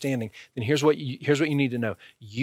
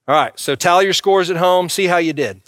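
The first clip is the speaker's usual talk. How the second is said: ordinary talk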